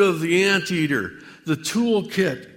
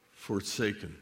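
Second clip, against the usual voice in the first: "forsaken" dropped to a near-whisper, softer and lower; hushed; 7 semitones lower, 12 dB quieter than usual. Real, not acted. Telling the Lord he dislikes this word, hushed. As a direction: let "forsaken" sink away, barely voiced.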